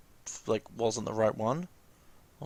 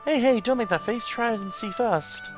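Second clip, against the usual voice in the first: higher little companion voice, gently insistent; coaxing familiar character; 9 semitones higher than usual. Acted. Familiar urging the player to keep the present, coaxing. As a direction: coax them as the helpful game mascot.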